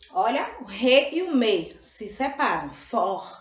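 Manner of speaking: ordinary delivery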